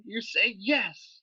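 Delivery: drawn out, very slow "you say yes"; slow scolding. Acted. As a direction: lecture him slowly, word by word. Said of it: "when your family asks you".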